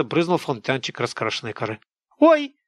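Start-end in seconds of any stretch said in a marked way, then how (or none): 2.2-2.5 high startled "Ой!", loud and sharp; a woman jumping with fright; shriek. A narrator's reading. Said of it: "взвизгнула жирная тётка". (испуганный взвизг)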